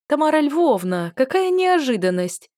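high, loud happy cry; cheerful exclamation. A narrator's reading. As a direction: exclaim in joyful surprise. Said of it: "весело воскликнула я". (весёлое восклицание)

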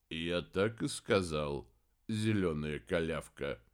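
low, slow, unbothered mumble; droning mumble. A narrator's reading. Стрек repeats the insult to Хелений, droning mumble. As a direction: drone it low and softly. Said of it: "пробубнил тот".

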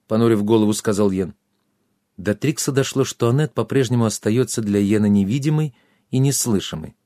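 ordinary delivery, nothing marked

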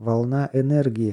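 plain reading voice

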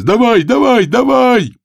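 high, loud cheering yell; urging shout. Acted. Another gambler urges on a cockroach, high yelling. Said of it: "Другой голос".

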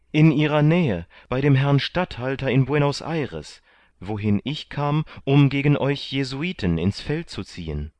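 high and loud, spilling the news; animated, high-pitched reply; eager, excited answer. A narrator's reading. Candide eagerly answers where she is.

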